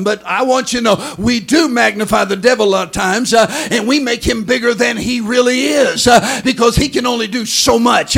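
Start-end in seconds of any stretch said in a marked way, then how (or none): 1.2-8.2 loud, ringing preacher's build; full-force preaching. Acted. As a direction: thunder it at the congregation.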